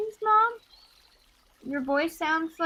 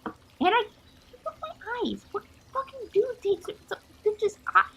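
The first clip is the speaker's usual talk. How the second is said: near-sobbing outburst; 2 semitones higher, faster than usual. Acted. Mina to the mother, distraught over losing her eyes. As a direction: let the panic crack through the words. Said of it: "she just kinda starts sobbing".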